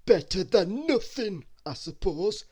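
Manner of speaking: Mister Greedy, shrugging off a cake, in a high slow voice; greedy character voice. Acted.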